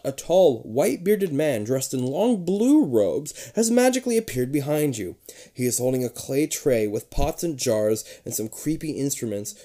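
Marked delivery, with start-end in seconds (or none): none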